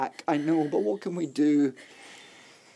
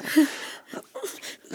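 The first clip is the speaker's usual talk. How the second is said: airy giggle, high up; husband laughing as he teases his wife; breathy high laugh. Acted. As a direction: laugh under the breath while teasing. Playful teasing of wife.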